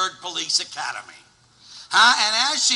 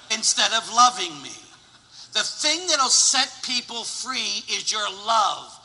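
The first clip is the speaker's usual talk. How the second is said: normal talking voice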